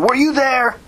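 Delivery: very high, insistent repeat; demanding. A narrator's reading. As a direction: press the challenge harder. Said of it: "Max demanded".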